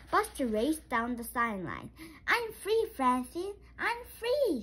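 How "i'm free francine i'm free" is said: high calling shout. A narrator's reading. Buster calling to Francine for the pass, shouting. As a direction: call out down the sideline. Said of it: "he shouted".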